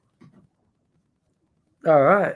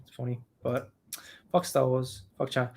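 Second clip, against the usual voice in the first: usual speaking voice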